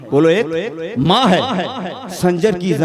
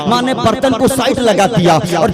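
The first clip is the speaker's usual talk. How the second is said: storytelling that swells a little louder and higher; rising sermon narration; 4 semitones higher, 4 dB louder than usual. Acted. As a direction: build the story toward the mother's words.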